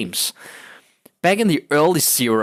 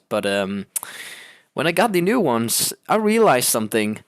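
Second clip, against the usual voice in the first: ordinary talk, nothing marked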